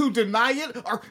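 hammed-up angry reading breaking into laughter; mock angry rant. Co-host mimicking an article's angry writer, mock rant. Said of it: "they wrote those words very angrily".

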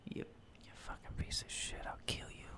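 hissed mock threat; menacing whisper.

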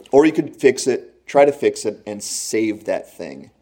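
ordinary talk, nothing marked

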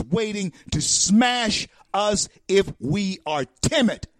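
high, loud, slow preaching at full force; rally the crowd with a booming, drawn-out warning; fiery preaching. Acted. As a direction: preach it like a pulpit call to arms.

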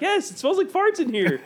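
high-pitched mock complaint; imitated complaint. Imitating friend complaining about his car, high-pitched insistence.

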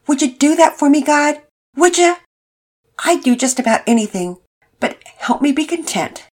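put-on a boy pleading with God aloud; prayer. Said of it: "he prayed aloud".

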